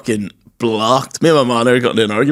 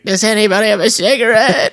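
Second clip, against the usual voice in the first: imitating a drunk aunt; 10 semitones higher than usual. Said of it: "she was like".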